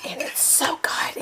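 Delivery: breathy whispered delight. Real, not acted. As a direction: whisper it like a secret treat. Savoring her salad.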